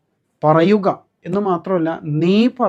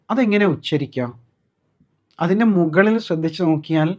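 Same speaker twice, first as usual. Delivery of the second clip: ordinary talk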